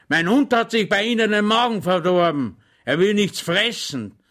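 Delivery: put-on high, loud, blustering old colonel; grumpy rambling colonel. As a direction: lecture him pompously and grumpily. Colonel complains about his dog. (mürrischer, polternder Oberst)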